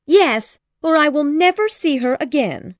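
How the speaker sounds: agitated plea. Acted. Wife urging husband, high frantic plea. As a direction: beg your husband in a flurry. Mrs Bennet voiced.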